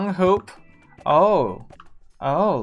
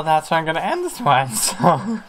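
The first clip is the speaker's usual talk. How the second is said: usual speaking voice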